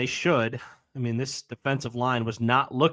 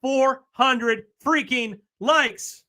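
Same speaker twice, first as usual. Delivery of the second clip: excited; 7 semitones higher, much slower than usual. Real, not acted. Stunned at hitting 400 likes.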